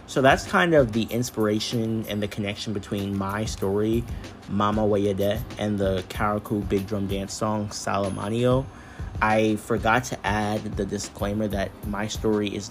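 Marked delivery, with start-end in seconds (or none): none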